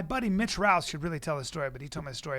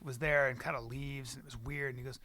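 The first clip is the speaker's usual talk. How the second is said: ordinary talk, nothing marked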